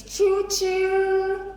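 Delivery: high sing-song choo-choo like a train. Shown as a demonstration. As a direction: call out a train whistle. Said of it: "The sound a train makes".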